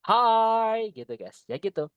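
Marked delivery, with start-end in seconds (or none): 0.0-0.9 "hi" drawn out high and loud as a greeting. Shown as a demonstration. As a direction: stretch "hi" on an open eye sound. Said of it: "it sounds like you wanna say".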